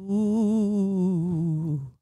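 long wavering ghostly "ooh"; drawn-out spooky wail. Acted. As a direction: moan like a cartoon ghost. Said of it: "heard a ghost go".

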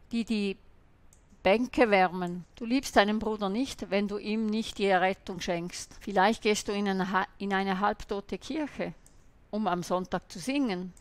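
ordinary delivery

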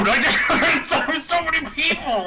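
laughing, yelling with excitement; excited laughing shout. Real, not acted. Excited kill streak yelling.

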